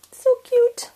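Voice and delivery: a delighted high squeak of cute; squeaky high voice. Real, not acted. Crafter squealing at her finished card, high and squeaky. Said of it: "she's in her high-pitched squeaky voice".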